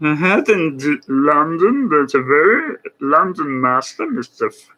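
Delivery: hammed-up German accent; Emanuel Lasker. Presenter imitating a German chess writer, mock German accent. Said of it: "He, he was German".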